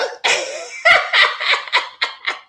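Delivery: a high giggle at a silly claim; high airy laugh. Real, not acted. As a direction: burst of breathy laughter, high up. Laughing after the reply.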